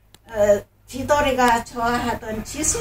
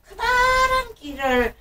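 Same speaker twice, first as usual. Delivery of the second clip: high squeal; 11 semitones higher than usual. A narrator's reading. Big ears pushed in.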